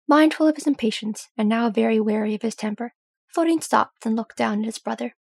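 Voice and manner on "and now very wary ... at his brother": plain reading voice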